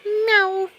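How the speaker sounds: high imitated cat meow; mock meow. Meowing at his cat.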